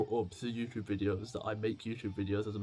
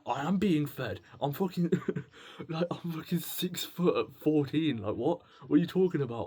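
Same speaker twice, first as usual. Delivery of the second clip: louder, higher, exasperated comeback; indignant protest; 5 semitones higher, 5 dB louder than usual. Acted. Man protesting to family who say feed the boy, indignant. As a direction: push back indignantly, stressing the words.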